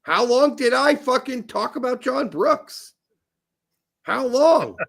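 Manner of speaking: high-pitched, exasperated challenge; indignant protest. Real, not acted. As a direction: demand an answer, aggrieved. Disputes the fake German charge.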